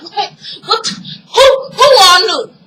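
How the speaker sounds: high, loud demand, acting out someone losing it; retold outburst. Andre losing it over who knew, loud and high. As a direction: fire off stunned questions, rising in pitch and volume.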